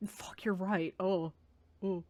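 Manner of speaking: ordinary talk, nothing marked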